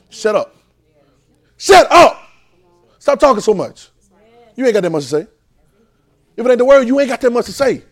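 high, loud shut up; preacher rebuking big mouths; scolding shout. Acted. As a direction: tell the talkers off.

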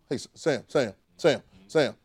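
calling a name over and over. Pretend. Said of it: "you calling like that".

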